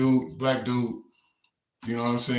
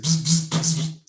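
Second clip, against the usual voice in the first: louder, higher electric buzzing; imitated TV buzz; 7 semitones higher, 6 dB louder than usual. TV shorting out after the water, buzzing sound. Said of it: "T V said".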